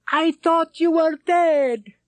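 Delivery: high, slow joyful yell. Acted. Rashid yells on finding the lost men, overjoyed shout. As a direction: shout it in relief, drawn out. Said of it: "Rashid yelled".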